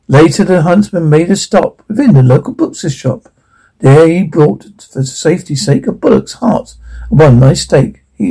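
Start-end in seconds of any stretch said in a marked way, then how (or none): none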